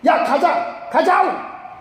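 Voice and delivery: high, shouted North Korean order; North Korean accent. Acted. General ordering his men to leave, shouted North Korean accent. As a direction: mimic an angry North Korean commander calling out. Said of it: "이북 사람 같으면 그렇게 얘기했어요".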